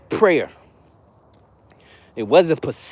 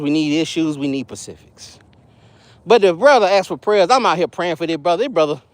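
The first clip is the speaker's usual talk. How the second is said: ordinary talk, nothing marked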